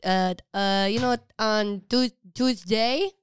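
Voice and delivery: imitating a Filipino mom, lilting upward; Filipino accent. Daughter's friend mimics mom announcing covid, Filipino accent. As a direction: put on a Filipino accent, rising at phrase ends. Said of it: "in Filipino accent".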